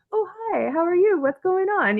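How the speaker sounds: high-pitched, chirpy mock greeting; friendly lawyer. Friendly lawyer greeting a client, imitated high and chatty. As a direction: greet them sweetly in a high voice. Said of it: "I'm used to friendly lawyers".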